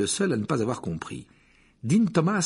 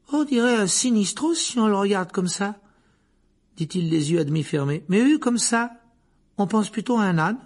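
higher, musing pupil; Seamus voice; 10 semitones higher, 4 dB louder than usual. Acted. Seamus squints at the cup, musing. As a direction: muse about the shape, head tilted.

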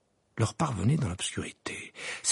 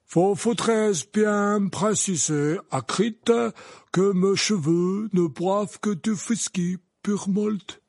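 higher, slow insistent lady; grand lady voice; 9 semitones higher, 5 dB louder, slower than usual. Acted. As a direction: insist slowly and firmly. Madame Maxime voiced.

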